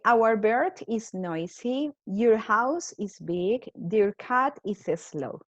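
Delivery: slow, careful reading of example sentences; slow reading aloud. Shown as a demonstration. Teacher to her class, slow and careful reading. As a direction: read each sentence slowly for the class. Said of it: "teacher reading examples aloud".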